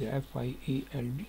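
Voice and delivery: spelling out quickly and quietly; trailing spelling. Shown as a demonstration. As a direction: murmur the English spelling softly. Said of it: "انگریزی میں field".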